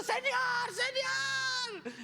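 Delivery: very high, loud comic shouting; Pedro. Acted. Disciple pestering Jesus, high loud calling. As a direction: squeal out for attention in falsetto. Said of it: "Yo imagino a Pedro diciendo".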